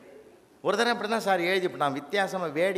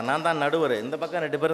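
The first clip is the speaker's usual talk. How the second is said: normal talking voice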